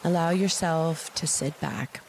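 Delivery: slow meditation voice with long pauses; unhurried, calming guidance; guided meditation. Acted. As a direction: guide them gently into relaxation, phrase by phrase.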